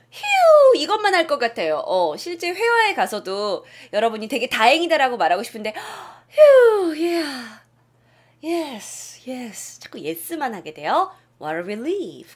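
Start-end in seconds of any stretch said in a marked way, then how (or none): 5.4-6.9 relieved gasp and sigh. Pretend. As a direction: gasp then a long "휴".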